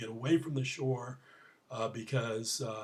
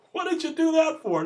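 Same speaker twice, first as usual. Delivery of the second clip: high, loud, quick acted protest; exasperated outburst; 11 dB louder, faster than usual. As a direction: shout in mock disbelief at a foolish friend. Trapped man scolds friend who jumped in.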